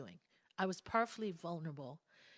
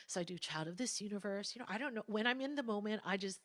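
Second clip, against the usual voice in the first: usual speaking voice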